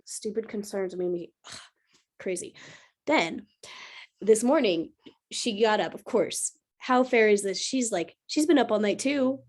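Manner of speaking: ordinary talk, nothing marked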